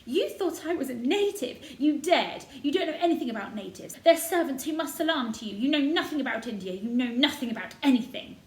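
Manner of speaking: high furious tirade; raging. A narrator's reading. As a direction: hurl it at her in rage. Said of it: "her rage and humiliation".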